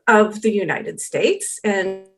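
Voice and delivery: normal talking voice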